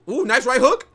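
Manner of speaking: sudden loud high yell of delight; excited shout. Real, not acted. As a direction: react to the big punch like a fan. Reacting live to a punch.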